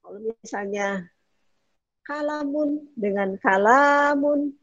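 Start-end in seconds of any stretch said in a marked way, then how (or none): 2.1-4.5 second kalamun with the lam held longer; slow, stretch the la in kalamun; lengthened vowel contrast. Shown as a demonstration. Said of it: "beda intonasi ya". (kalamun kedua dengan lam dipanjangkan)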